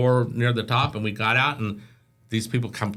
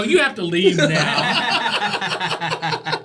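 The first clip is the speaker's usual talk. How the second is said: slow firm dismissal; 7 semitones higher, 4 dB louder, much slower than usual. Retold or imitated. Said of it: "and they go".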